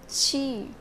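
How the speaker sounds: Qi as "chee"; Qi as one syllable, "chee"; correct Qi pronunciation. Shown as a demonstration. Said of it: "The pronunciation of this brand is".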